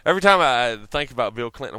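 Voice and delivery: ordinary talk, nothing marked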